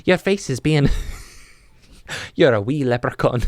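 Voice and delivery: lilting put-on Irish, breaking into laughter; Irish accent. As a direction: slip into a playful Irish brogue. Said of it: "I turned Irish for a second there".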